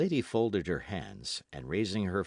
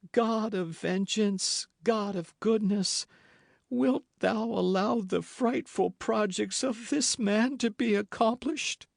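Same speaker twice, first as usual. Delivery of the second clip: mock-tearful lament; voice broken by tears; 13 semitones higher, 3 dB louder than usual. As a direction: pray as if near weeping. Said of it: "in a voice broken by tears".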